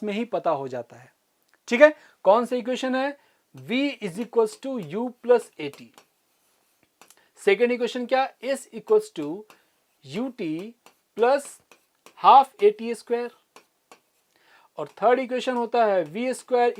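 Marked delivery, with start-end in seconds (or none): 3.5-5.8 each symbol spaced out slowly; slow dictation. Shown as a demonstration. Teacher to students writing notes, slow clear dictation. 11.2-13.3 slow dictation. Shown as a demonstration. Teacher dictating second equation.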